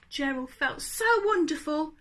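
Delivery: plain reading voice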